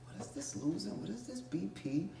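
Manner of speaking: very soft, dazed inner question; puzzled murmur. Retold or imitated. Patient fading in the ambulance wonders at the words, quiet and confused. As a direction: wonder it faintly to yourself.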